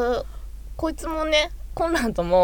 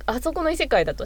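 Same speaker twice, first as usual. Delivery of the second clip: ordinary talk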